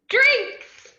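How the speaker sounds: very high, loud stretched squeal; drunk squeal. Acted. Drunk Harley squealing yes to the creeper's offer.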